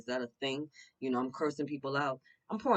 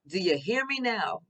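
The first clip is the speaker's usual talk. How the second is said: loud, raised-pitch mock phone check; Verizon commercial; 6 semitones higher, 7 dB louder than usual. Reader checking the Divine Feminines hear her, commercial-style call-out. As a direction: call it out like an ad catchphrase. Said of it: "It's like the Verizon commercial".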